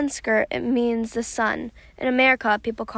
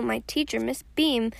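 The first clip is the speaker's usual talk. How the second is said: plain reading voice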